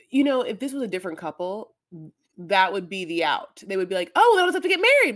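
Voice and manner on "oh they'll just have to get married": high, loud mock realization; mock eager solution. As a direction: blurt it out as a bright idea, hammed up. Acts another couple's easy solution.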